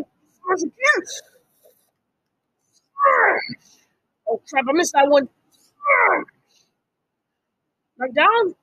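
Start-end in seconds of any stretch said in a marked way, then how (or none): 2.7-3.5 high battle cry; hammer shout. Real, not acted. 5.5-6.3 hammer shout. Real, not acted. Battle cry swinging hammer.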